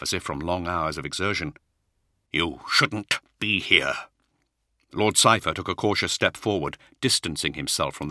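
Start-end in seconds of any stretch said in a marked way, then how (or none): 2.3-4.1 rough voice. Acted. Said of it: "His voice was rough".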